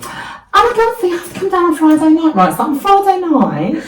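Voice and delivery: high-pitched, loud Essex gush; chatty mum put on in exaggerated Essex; mock Essex mum. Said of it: "she's not from Essex, by the way, but she is now".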